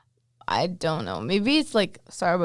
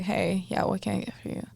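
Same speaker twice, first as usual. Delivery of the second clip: bored; 9 dB quieter than usual. Retold or imitated. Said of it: "I would hate myself".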